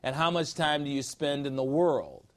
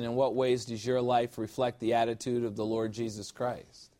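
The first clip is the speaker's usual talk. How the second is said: usual speaking voice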